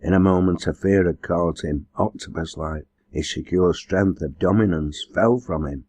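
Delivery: ordinary delivery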